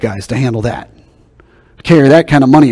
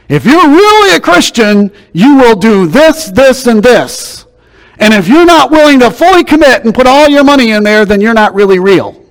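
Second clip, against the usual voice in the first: much higher, louder hammed-up demand; mock legalistic preacher; 10 semitones higher, 6 dB louder than usual. Preacher mocking TV preachers demanding money, high and loud. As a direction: lampoon the fire-and-brimstone TV preacher. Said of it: "They say something like this".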